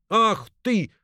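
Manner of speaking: high startled exclamation; shocked cook crying out; cook's startled gasp. A narrator's reading. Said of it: "ахнула стрепуха".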